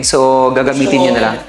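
normal talking voice

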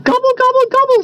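high, rapid turkey gobble; greedy turkeys. A narrator's reading. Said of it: "said the greedy turkeys".

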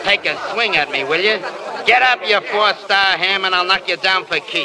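put-on press-agent daring a drunk actor to fight; taunting threat. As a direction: dare him to get up and fight. Libby goads Maine to fight.

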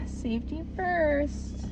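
sarcastic. Real, not acted. Vlogger tossing off a safety reminder before leaving, sarcastic. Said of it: "I said that sarcastically".

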